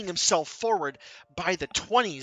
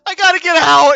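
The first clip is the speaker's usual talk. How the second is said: much higher and louder frantic yell; panicked screaming; 12 semitones higher, 19 dB louder than usual. Acted. Escaping patient screams as he flees the sanatorium. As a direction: scream it like you're running for your life.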